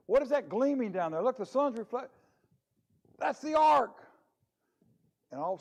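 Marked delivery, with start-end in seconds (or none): 0.1-4.0 rising high and loud to a cry of discovery; excited discovery. Acted. Soldier spotting the ark, shouting in excitement. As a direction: spot the ark and yell it out, climbing.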